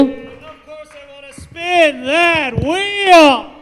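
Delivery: huge, slow stretched-out shout; high, booming game-show hype; rousing shout. Real, not acted. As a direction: bellow it to the crowd, dragging out the words. Co-host's shouted entrance.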